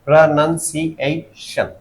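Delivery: Pronunciation slowed, each of its five syllables distinct. Shown as a demonstration. Teacher showing dictionary syllable breaks, slow and measured. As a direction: lightly separate pronunciation into pra-nan-si-ei-shan. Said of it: "जैसे ये लिखा हुआ pronunciation".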